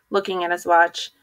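usual speaking voice

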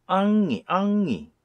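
엉이 called out high and loud; 엉이를 높고 크게 또박또박; pronunciation demo. Shown as a demonstration. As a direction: sing out 엉이 with the ng. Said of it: "엉이라고 합니다".

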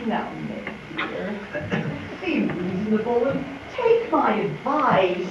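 hushed, coaxing voice; soft coaxing. Acted. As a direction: coax her gently, almost whispering. Aunt March softening her tone.

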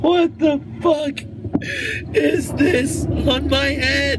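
high, slow, tearful wail; crying. Real, not acted. Man crying over his ruined hair, tearful wail. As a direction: let the crying take the words.

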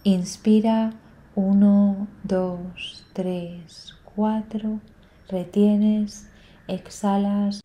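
slow, soft breath-counting with long pauses; calmly pace the listener's breath, letting each count fade; guided breathing meditation. Real, not acted.

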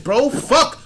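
ordinary delivery

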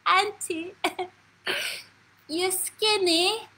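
put-on high, drawn-out child's call; bawling little grandniece. Grandniece blurting out a remark to her aunt, high child's bawl. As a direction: mimic a small girl calling out, stretching the words. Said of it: "all of a sudden I hear she bawl out".

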